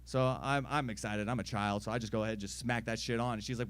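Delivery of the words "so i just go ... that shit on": ordinary talk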